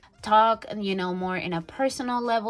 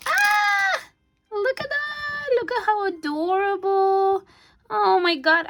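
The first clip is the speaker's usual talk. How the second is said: very high, loud gushing; excited high gushing; thrilled squeal; 12 semitones higher, 5 dB louder, slower than usual. Real, not acted. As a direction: squeal at how adorable it is. Gushing over decorations.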